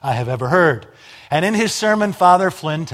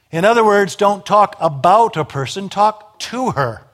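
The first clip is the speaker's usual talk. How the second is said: raised, ringing pulpit voice; loud, insistent sermon delivery; full-force preaching; 4 semitones higher, 6 dB louder than usual. Acted. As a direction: drive the message home from the pulpit. Preacher driving the point home.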